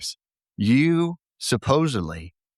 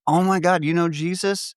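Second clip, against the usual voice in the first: excited alien; 8 semitones higher than usual. Acted. Alien voiced in joke.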